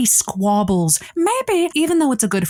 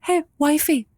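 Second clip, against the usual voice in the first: sweet talk; 7 semitones higher than usual. Retold or imitated. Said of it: "You go".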